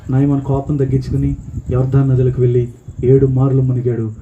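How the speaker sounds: ordinary talk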